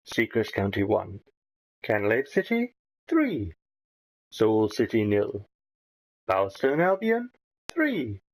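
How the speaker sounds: rising on home team, falling on away score; steady football results announcer voice; classified results read. Acted.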